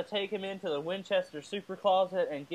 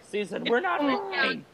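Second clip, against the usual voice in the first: a light, slow put-on Lady Skylar voice, a little higher; 8 semitones higher, slower than usual. Host voicing Lady Skylar for a season name, light and slow. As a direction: lightly play Lady Skylar, leaning on "not". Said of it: "go with Lady Skylar and be like".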